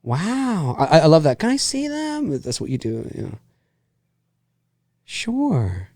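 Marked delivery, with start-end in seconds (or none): 1.4-2.4 high soft voice. Retold or imitated. Voicing a movie character.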